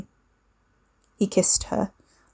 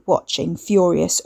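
ordinary delivery

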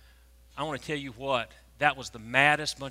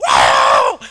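very loud whooping shout; mimicking a crowd going wild; imitated crowd roar; 14 semitones lower, 17 dB louder than usual. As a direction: erupt like a thrilled section of fans. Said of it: "the whole section just went".

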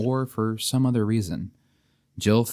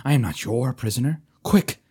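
urgent, loud pleading knight; loud and clear; 2 semitones higher, 3 dB louder than usual. Acted. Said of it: "he said loud and clear".

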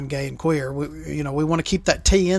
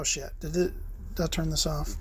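ordinary talk, nothing marked

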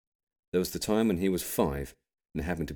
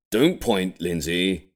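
grampy's gentle rebuke; 3 semitones higher, 7 dB louder, much slower than usual. A narrator's reading.

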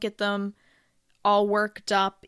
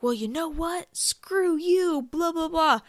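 mock snippy retort, pitched up high; rude comeback; 9 semitones higher than usual. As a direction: toss it off like a rude employee snapping back. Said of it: "for you to then turn around and be like".

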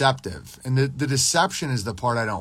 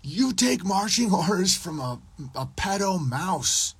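higher jeering voice, slowing at the end; mock taunt; 6 semitones higher than usual. Taunting Stephen A Smith, jeering. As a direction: sneer it out, stretching the last words. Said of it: "I would have been like".

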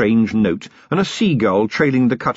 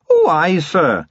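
high sly Scottish-tinged wee man; sly Feegle voice; 8 semitones higher, 3 dB louder, slower than usual. A narrator's reading. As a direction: play it sly and pleased. Wee Mad Arthur voiced.

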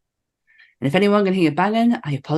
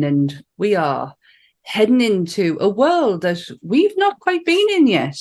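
ordinary talk

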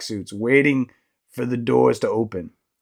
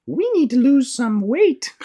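high, slow character voice; high-pitched story mom; elephant mother voice; 11 semitones higher, slower than usual. Acted. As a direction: pitch it way up and draw it out.

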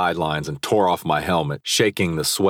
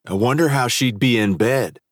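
smug taunt; 6 semitones higher than usual. A narrator's reading. Rival taunting.

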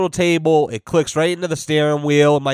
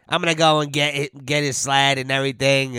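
lightly nasal imitation of a friend; a hint of a nasal voice, mocking. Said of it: "He's nasally".

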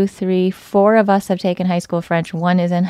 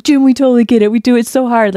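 higher and louder, ribbing tone; teasing brothers; 5 semitones higher, 9 dB louder than usual. Retold or imitated. Brothers ribbing Jim about his put-on accent, loud teasing. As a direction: tease him like her laughing brothers. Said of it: "they're like".

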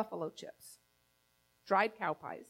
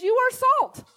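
lifted, louder proclamation, high; a preacher voicing Jesus, ringing out high and loud; proclaiming Jesus; 10 semitones higher, 7 dB louder than usual. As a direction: announce it like Jesus blessing the crowd. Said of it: "Jesus comes along and says".

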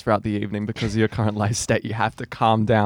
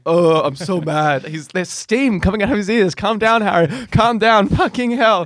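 higher, louder, hammed-up fuming rant; mock angry Harry; 9 semitones higher, 5 dB louder, slower than usual. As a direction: play a friend boiling over, slow at first. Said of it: "Harry going".

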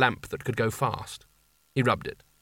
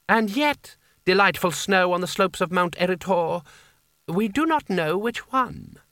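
florid Seraph voice; 6 semitones higher than usual. Acted. The Seraph answering Kanina's plan, high and flowery. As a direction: flatter her lavishly while pointing out the flaw. Creosote's florid courtly speech.

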